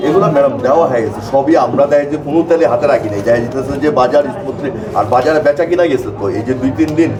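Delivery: ordinary delivery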